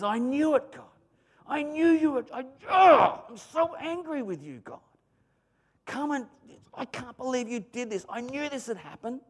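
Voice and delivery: high, whining complaint; a mock sulky rant at God; angry Jonah complaining to God. Jonah complaining to God, high and whiny. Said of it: "he's angry".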